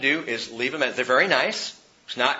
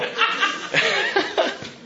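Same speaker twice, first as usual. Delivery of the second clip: high unvoiced laugh; breathy laugh. Real, not acted. Pastor laughing at his own joke, airy.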